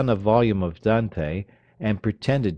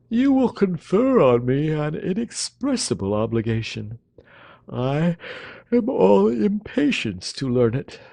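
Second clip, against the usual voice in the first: languid dandy; 9 semitones higher, 3 dB louder than usual. Acted. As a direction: sigh it out as too much effort.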